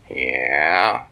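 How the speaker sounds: low, lazy rising yeah; a low, easygoing turtle answer. Acted. Turtle answering the visitors in a low lazy voice.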